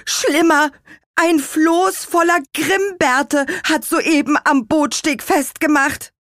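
very high, loud warning; alarmed announcement. A narrator's reading. Character breaks alarming news.

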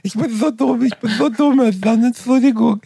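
hammed-up high whining self-pity; whiny crybaby. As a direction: whine it like a pathetic crybaby. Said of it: "heulende, lispelnde Lusche".

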